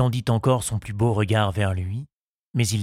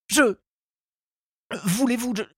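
high suitor's voice, breaking off; stammered proposal; 13 semitones higher than usual. A narrator's reading. Charles tries to propose to Henriette, stammering. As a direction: falter high and leave it unfinished.

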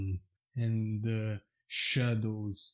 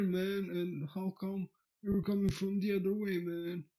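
high, laid-back dude voice; a stoner dude greeting his friend, man; Sasha's voice; 7 semitones higher than usual. Acted. Sasha greets his lookalike in a high dude voice. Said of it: "Sasha going".

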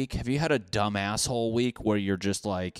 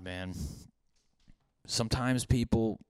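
ordinary talk, nothing marked

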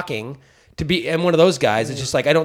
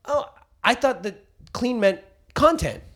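mock innocence; 8 semitones higher, slower than usual. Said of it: "And I go".

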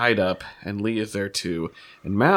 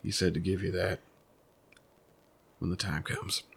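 softer, grave delivery; hushed parting words; 4 semitones lower, 10 dB quieter than usual. Acted. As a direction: hand it over quietly.

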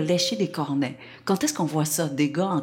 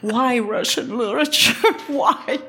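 voix haute, question exaspérée pour rire; réclamer une explication, faussement consterné; incrédulité comique; 7 semitones higher, 4 dB louder than usual. Retold or imitated. Incrédule devant la réponse de Marco. (incrédulité jouée, voix qui monte)

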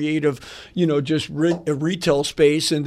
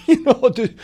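laughing through the words, higher and louder; amused laugh; 7 semitones higher, 6 dB louder than usual. Real, not acted. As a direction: chuckle it out.

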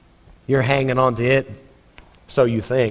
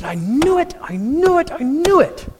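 high whining I knew it, much higher; a sulking prophet complaining; whiny upset Jonah; 5 semitones higher than usual. Acted. Jonah grumbling to God about mercy, high and whiny. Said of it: "He was upset".

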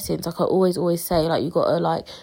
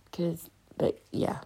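a breathy unvoiced trail-off; whispered; 10 dB quieter, slower than usual. Real, not acted. Podcaster running out of words, whispering the end. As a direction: let it drop to a whisper.

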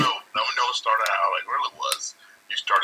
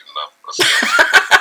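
laughing; 11 dB louder than usual. Real, not acted. Laughing while teasing.

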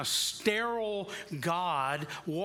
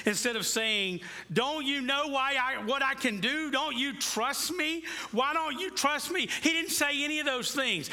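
fervent preaching; 10 semitones higher than usual. Acted. Pastor voicing the rebuke Jesus never gave, in full fervent preaching. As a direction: press the questions hard on the listeners.

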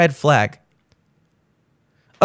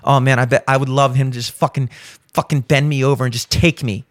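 imagined woman's thoughts; 6 semitones lower, faster than usual. Retold or imitated. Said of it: "She sees his arms".

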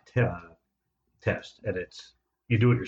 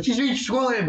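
much higher mock insistence; imitating test lady; 12 semitones higher than usual. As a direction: push back like the stubborn lady, way up high. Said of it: "and the lady's like".

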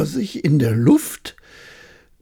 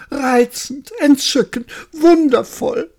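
rising higher with each word, delighted; gushing praise; 9 semitones higher than usual. Acted. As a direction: pile on the compliments, climbing.

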